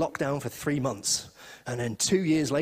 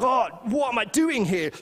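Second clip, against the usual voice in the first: urgent realization; 8 semitones higher than usual. Retold or imitated.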